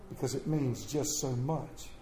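low, soft and hushed; hushed refrain. Acted.

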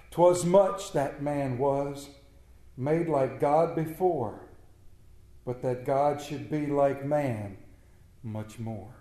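slow, hushed poem reading; poem recital. Acted. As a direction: linger on each line of the verse. Recites John Donne poem.

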